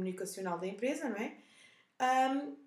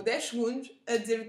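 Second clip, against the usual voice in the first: usual speaking voice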